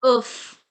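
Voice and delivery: oof with the final F sounded; French final F. Shown as a demonstration. Teacher showing last letter F, oof with sounded F. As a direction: say oof loudly, holding onto the closing F. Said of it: "we are pronouncing the last letter F".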